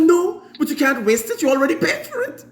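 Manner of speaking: high-pitched protesting mum; imitating mum, high and insistent; indignant mother. Acting out mother refusing waste.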